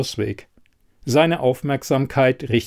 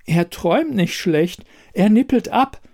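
dringend; 9 semitones higher than usual. A narrator's reading. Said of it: "sendete die Matratze mit höchster Priorität". (dringende Warnung der Matratze)

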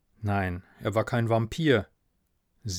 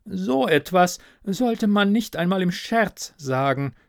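piqued, higher-pitched scolding; reproving; 7 semitones higher than usual. Acted. Offended ghost reproaches narrator for cursing, piqued scolding. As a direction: chide primly in a higher voice. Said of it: "tadelte er".

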